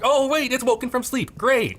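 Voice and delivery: loud, high-pitched sarcastic glee; mock delighted surprise. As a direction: act thrilled, pitch leaping way up. Acts out returning to slow computer.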